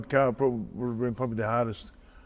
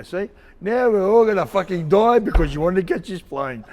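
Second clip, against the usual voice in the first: laughing accusation; 8 semitones higher, 7 dB louder than usual. Retold or imitated. Facing death on diving plane.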